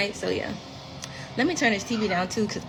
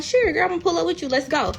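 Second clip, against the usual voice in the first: eager agreement; 7 semitones higher, faster than usual. Retold or imitated. Retold eager yes to invitation.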